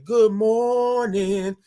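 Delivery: slow sing-out morning call; drawn-out greeting. Shown as a demonstration. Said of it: "y'all gotta say it like that".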